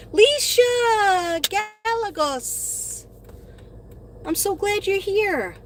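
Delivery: high, bright squeal of welcome; delighted high-pitched hello; excited greeting. Real, not acted. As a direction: greet her like a friend who just walked in. Greeting a viewer joining the live.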